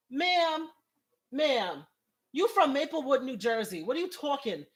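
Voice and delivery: high, loud incredulous calling-out; mock indignation. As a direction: challenge her as if she's fooling nobody. Mock challenge to the singer's accent.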